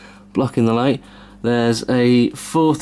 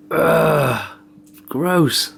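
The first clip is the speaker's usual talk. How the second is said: disgusted groan, then higher; annoyed ugh; 5 semitones higher than usual. Real, not acted. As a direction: recoil from the dirt in disgust. Opening a filthy PC.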